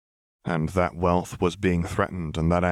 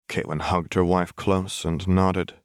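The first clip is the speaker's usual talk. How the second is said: ordinary delivery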